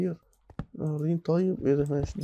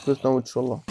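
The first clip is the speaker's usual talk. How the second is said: usual speaking voice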